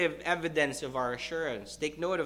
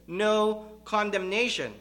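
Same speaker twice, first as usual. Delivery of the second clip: "No" pitched high, leading into "condemnation"; emphatic NO; 5 semitones higher, 3 dB louder than usual. Real, not acted. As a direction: punch "No" as a firm negation. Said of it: "You can not be more emphatic".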